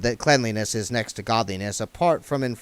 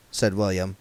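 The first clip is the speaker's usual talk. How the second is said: plain reading voice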